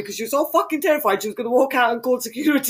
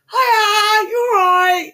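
high, loud, sing-song "Hiya"; put-on normal voice; 12 semitones higher, 8 dB louder, much slower than usual. Greeting the cashier, trying to sound all normal, high and bright. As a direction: fake cheery greeting, pitched way up. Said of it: "Trying to sound all normal".

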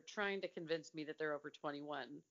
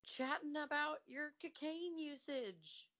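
incredulous; 9 semitones higher than usual. Retold or imitated. Mock outrage at casual drug talk.

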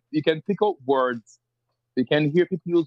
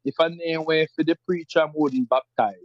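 country Jamaican patois, raised; rural Jamaican patois; 3 semitones higher than usual. Shown as a demonstration. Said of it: "rural parts of Jamaica would say".

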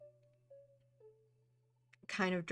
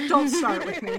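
louder, annoyed telling-off; loud scolding; 6 semitones higher, 12 dB louder than usual. Acted. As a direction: bark it at the friend. [name] slaps arm, scolding.

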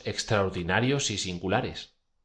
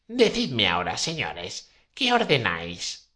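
witch voice. Acted. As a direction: pitch up into an old crone. Witch speaks again. (voz aguda de bruja vieja)

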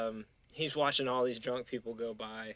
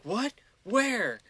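confused surprise; 9 semitones higher, 3 dB louder than usual. Retold or imitated. Drunk girlfriend confused on phone.

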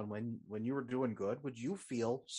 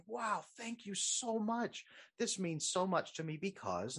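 high, slow, delighted thank-you; 7 semitones higher than usual. Retold or imitated. Partner thanking for a cool gift, delighted. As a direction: gush gratefully over a gift. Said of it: "they say".

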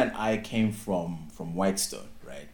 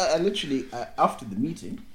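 normal talking voice

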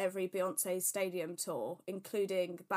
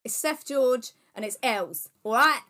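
much louder jokey introduction; mock announcement; 4 semitones higher, 11 dB louder than usual. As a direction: announce your names like a big radio show. Jokingly announcing podcast hosts.

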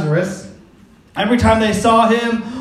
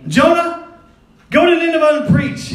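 voice of God; 7 semitones higher, 3 dB louder than usual. Acted. God's command voiced in sermon.

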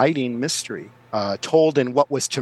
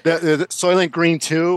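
ordinary talk, nothing marked

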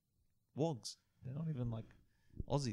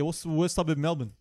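loud, upbeat street interviewer; 4 semitones higher, 10 dB louder than usual. Acted. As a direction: hype it up like a host. Plays TikTok interviewer.

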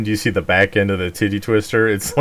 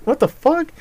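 high disbelieving what the fuck; incredulous. Retold or imitated. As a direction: pitch it up in shock. Quoting own reaction to comic.

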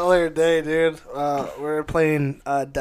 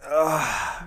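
mock groan. Shown as a demonstration. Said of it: "I just do that".

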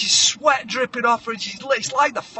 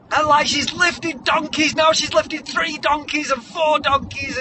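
ordinary delivery

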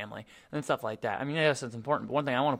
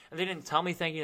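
ordinary talk, nothing marked

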